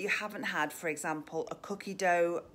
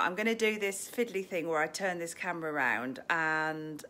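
ordinary talk, nothing marked

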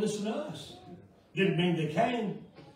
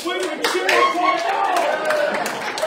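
slowed and louder, relishing the punchline; gleeful loud quote; 8 semitones higher, 9 dB louder, slower than usual. Retold or imitated. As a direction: lean into the crowd's glee, slow. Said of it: "They said".

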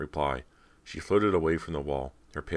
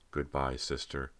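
slow, cold farewell; 4 dB quieter than usual. A narrator's reading. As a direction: say it without any feeling. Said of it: "Callie replied coldly".

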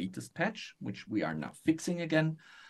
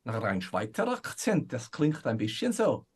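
Swiss German accent. Shown as a demonstration. Guest demonstrating his dwarf voice for the host, Swiss German accent. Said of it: "I can do a Swiss accent".

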